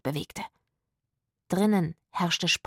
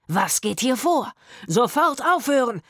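raised, stern bellow; commanding shout; 4 semitones higher, 4 dB louder than usual. A narrator's reading. Man bursting in on a fight, commanding shout. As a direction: shout an order to break up a fight. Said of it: "schrie er".

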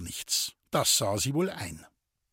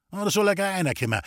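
quick, higher-pitched eagerness; eager call; 8 semitones higher, 3 dB louder, much faster than usual. A narrator's reading. Forester calls the waiting huntsman in, eager call. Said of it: "rief der Förster eifrig".

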